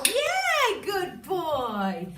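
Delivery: very high squeal of yeah then praise; high gushing praise; excited praise. Real, not acted. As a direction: squeal with delight at the dog. Praising dog.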